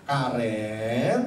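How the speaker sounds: put-on drawn-out call of the child's name; long, rising fatherly call. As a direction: call your daughter, stretching the last syllable. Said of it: "lebih kencengin lagi suaranya".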